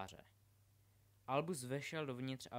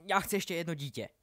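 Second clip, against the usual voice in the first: loud, higher, blurted outburst; sudden blurt; 4 semitones higher, 8 dB louder than usual. A narrator's reading. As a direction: blurt it out as a desperate diversion. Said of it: "vyhrkl najednou". (náhle a hlasitě vyhrknout)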